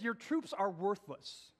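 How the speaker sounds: ordinary talk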